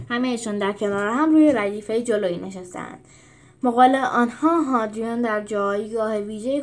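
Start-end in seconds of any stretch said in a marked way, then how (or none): none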